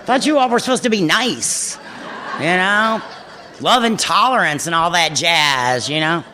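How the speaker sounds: half-laughing grumble at the sponsor; mock indignant. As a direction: tease back at the old man. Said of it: "And I would think".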